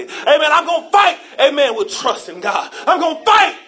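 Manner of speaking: loud building preacher's cadence, shouted peaks; preaching. Acted. Pastor to congregation, preaching with full force. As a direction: preach it hard, building each line.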